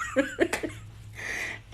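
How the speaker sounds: high airy laughter; breathy laugh. Real, not acted. After quoting herself to mom, soft high giggle.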